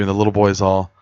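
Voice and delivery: normal talking voice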